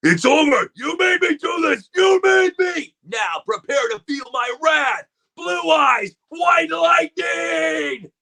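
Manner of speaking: high, loud shouting duelist voice, slow on the final call; anime villain rant. Acted. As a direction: roar it like an anime rival unleashing his attack. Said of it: "going through all his voices".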